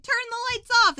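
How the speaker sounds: high, loud shout of alarm; mock yell. Shoppers yelling at the hillbillies in a high mock shout.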